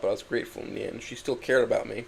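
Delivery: usual speaking voice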